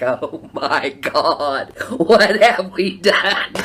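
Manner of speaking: slow, loud laugh-through dismay. Retold or imitated. Hearing the election result on TV, laughing dismay. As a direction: laugh out the panic, drawn out. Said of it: "I was like".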